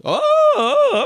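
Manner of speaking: high, loud startled oh oh; startled cop. Retold or imitated. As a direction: jump back in surprise. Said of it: "And he goes".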